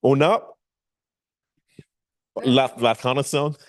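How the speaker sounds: halting, clumsy French with laughs; foreign accent in French. Pretend. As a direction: fumble through some French. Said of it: "Trying to speak French is not my thing".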